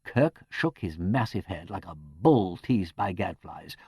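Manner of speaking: plain reading voice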